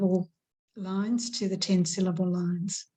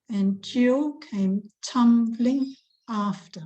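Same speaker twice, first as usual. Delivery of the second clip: slow, "Jill" and "tumbling" punched; slow teacher stress on "Jill"; 3 semitones higher, slower than usual. Shown as a demonstration. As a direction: lean on "Jill" and "tumbling" slowly. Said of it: "Where is the emphasis".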